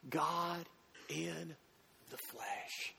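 falls from soft to a breathy near-whisper; soft reverent fade to a whisper; fading to hush. Real, not acted. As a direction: let "God in the flesh" die away in awe.

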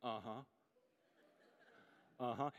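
low, noncommittal uh-huh, rising at the end; distracted husband's token agreement; absent-minded. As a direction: mumble along as if not really listening. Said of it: "Just to see if I'm listening".

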